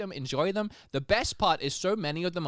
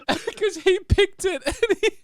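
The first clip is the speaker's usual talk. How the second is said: raised, loud, laughter bubbling through; real laughing; 6 dB louder, slower than usual. Real, not acted. Host telling what the intern's face did, laughing. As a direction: tell it while fighting off giggles. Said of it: "I'm laughing because as I was listening".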